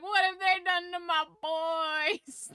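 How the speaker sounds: high, slow, crying mock lament; mock wail. Fan mock-wailing over a ruined childhood film, crying. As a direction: wail it like a heartbroken parent. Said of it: "it hurts my heart because I'm like".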